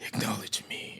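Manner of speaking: soft, unvoiced timid acknowledge me; timid whisper. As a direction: shrink back and whisper it weakly. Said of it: "he has it so timid".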